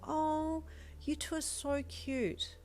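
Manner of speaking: high, slow and sweet; gushing girl voice. Acted. Girl gushing over couple.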